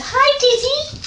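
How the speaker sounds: very high, squeaky call to a pet; squeaky dog voice. Real, not acted. Vlogger greeting the dog coming upstairs, squeaky dog voice. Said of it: "my squeaky dog voice".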